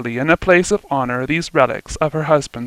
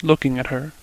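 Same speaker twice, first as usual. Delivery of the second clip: ordinary delivery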